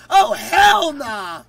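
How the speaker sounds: loud, drawn-out refusal; shouted refusal. Real, not acted. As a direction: yell it out in disbelief. Refusing fifty push-ups.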